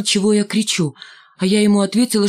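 ordinary delivery

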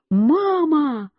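high-pitched, long-held call for mother; child's cry. A narrator's reading. Lost boy sees his mother run in, crying out. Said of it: "Я как закричу".

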